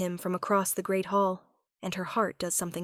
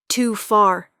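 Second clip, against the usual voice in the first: loud, sharp "Too far!"; bitter outburst; 9 dB louder than usual. Acted. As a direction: spit the words back in bitter scorn. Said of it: "Thorn laughs bitterly".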